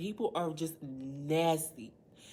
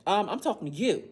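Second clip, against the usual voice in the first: put-on loud, pitched-up retort to the boy; confrontational comeback; 4 semitones higher, 7 dB louder than usual. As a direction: throw it back at him with crazy energy. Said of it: "it was just crazy energy".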